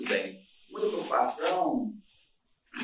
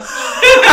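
loud laugh; 16 dB louder than usual. Real, not acted. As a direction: burst of high loud laughter. Laughs about shouting at door. (gargalhada alta)

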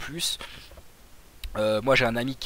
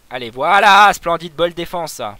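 higher and much louder, a burst of delight; excited cheer; 5 semitones higher, 14 dB louder, slower than usual. Real, not acted. Gamer cheering a great defensive play to viewers, loud and excited.

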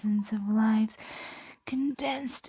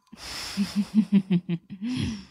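pleased laugh. Acted. Dragon pleased captive stopped crying.

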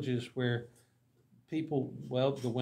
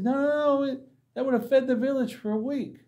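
high, loud, incredulous protest; dismayed villagers; 11 semitones higher, 6 dB louder than usual. Retold or imitated. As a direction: cry out in disbelief at the waste. Villagers explain deer was food.

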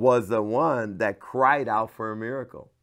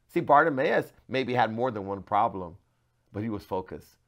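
normal talking voice

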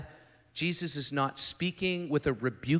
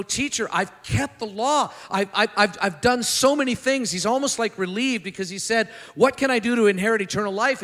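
high, loud, eager rush of claims; eager boasting; 6 semitones higher, 8 dB louder than usual. Retold or imitated. Young man eagerly telling Jesus he kept the law, high and loud. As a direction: pitch it like a proud kid listing chores.

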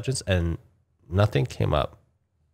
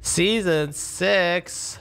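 much higher, very slow disbelieving question; can't believe it's that far off; incredulous; 9 semitones higher, much slower than usual. Real, not acted. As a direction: drag it out in disbelief. Hearing the release timing.

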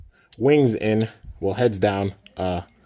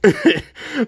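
giddy high laugh; 3 dB louder than usual. Real, not acted. Laughing after dropping the toy.

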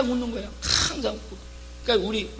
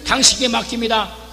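louder, crying out the prayer; 큰 소리로 외치는 기도; 4 dB louder than usual. Shown as a demonstration. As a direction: cry out your burden to God. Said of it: "큰 소리로".

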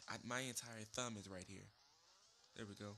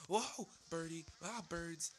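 high, loud cries of alarm; startled yelling; 6 semitones higher, 6 dB louder, slower than usual. Real, not acted. Gamer startled by birds in the game, yelping loudly. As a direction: yelp at a sudden attack.

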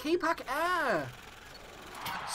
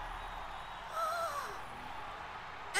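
sharp breathless gasp; excited gasp; 11 dB quieter than usual. Real, not acted. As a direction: gasp in delight. Gasp at award.

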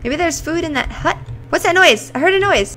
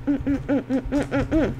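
eating hum; 5 dB quieter than usual. Pretend. Eating noises.

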